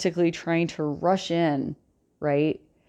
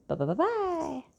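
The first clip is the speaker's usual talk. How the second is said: sing-song bye; 12 semitones higher, 5 dB quieter than usual. Acted. Sing-song goodbye at video end.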